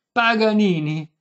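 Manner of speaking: very loud, drawn-out bellow; empty your lungs on the name; full-lunged shriek. A narrator's reading. Violinist at deaf old lady, slow full-lunged shout. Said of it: "strillò Paganini con quanto fiato aveva".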